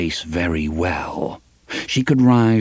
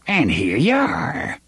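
put-on slow, higher-pitched old hag; drawn-out witchy greeting; old witch; 6 semitones higher, slower than usual. A narrator's reading. Old woman greets the girl at her door, witchy.

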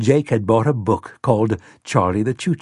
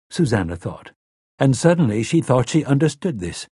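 usual speaking voice

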